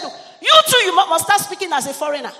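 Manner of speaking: high, shouted mock insistence; loud retold order. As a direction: order the teachers at full volume.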